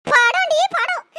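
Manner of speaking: put-on stepped-on old woman yelling back; angry yell. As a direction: bark back at her in pain. Stepped on repeatedly.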